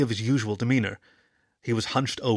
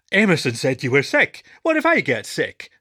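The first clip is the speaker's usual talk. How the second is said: louder, rising fear; panicky pup; 3 semitones higher, 6 dB louder than usual. Acted. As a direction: blurt it out in alarm. Frightened pup Tom.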